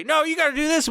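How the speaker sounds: high, bossy celebrity voice; mock celebrity order. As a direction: order the fans around, hammed-up. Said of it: "the next day they'll say".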